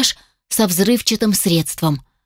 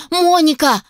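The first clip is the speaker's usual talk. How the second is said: higher, urgent call of Моника; warning cry; 7 semitones higher than usual. Acted. Inventor shouts a warning to his assistant, urgent cry. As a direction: cry out Моника to stop her. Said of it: "воскликнул, предостерегая её".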